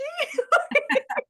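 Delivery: bright, high burst of real laughter. Real, not acted. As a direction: laugh out at your own story. Laughing at own story.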